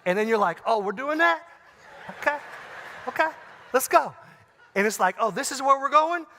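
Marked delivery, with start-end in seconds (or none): 0.7-4.1 high-pitched, taken-aback player squaring up; mock competitive challenge. Acting out a ping-pong rally turning competitive. 5.2-6.3 high, loud, taken-aback question; pitched-up oh-so-it's-like-that reaction; mock incredulous challenge. Acted reaction to escalating conflict.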